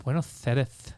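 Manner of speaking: Castilian Spanish, Buenos with a lisped th. Retold or imitated. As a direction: Buenos Aires the Spanish way, lisping. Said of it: "you have the Spanish, like".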